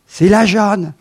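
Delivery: ordinary delivery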